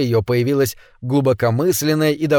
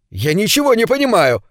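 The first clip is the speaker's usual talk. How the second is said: a higher, half-desperate cry, stress on ничего; protest, leaning on ничего; 8 semitones higher than usual. A narrator's reading. Ned crying out to the silent crowd, ничего stressed. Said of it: "выкрикнул он".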